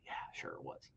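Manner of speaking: whispered, disbelieving aside; sarcastic whisper. Real, not acted. Host scoffing at the claim to viewers, in a whisper. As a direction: scoff under your breath.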